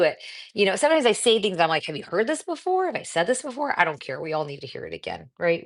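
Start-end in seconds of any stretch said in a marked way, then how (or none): 1.9-3.7 mock self-doubt. Voicing her own worried thoughts.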